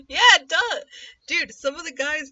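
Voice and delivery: loud, high burst of agreement; excited exclaim. Real, not acted. Reacting to the clip, loud excited agreement. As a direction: shout it out, thrilled he sounds the same.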